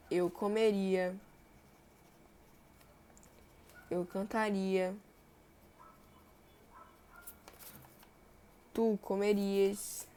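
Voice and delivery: comeria, cantaria, comerias said slowly, each ria ending drawn out; slow teacher listing verbs; slow ending demo. Shown as a demonstration. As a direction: dictate the verb list slowly, leaning on the endings. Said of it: "terminam em ria ou rie".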